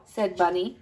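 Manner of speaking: plain reading voice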